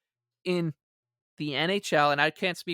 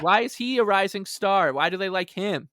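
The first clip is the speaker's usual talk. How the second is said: raised and louder, incredulous why; mock exasperated questioning; 4 semitones higher, 6 dB louder than usual. Columnist mocking the Leafs' praise, incredulous and loud. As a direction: act baffled by the hype. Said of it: "And he's like".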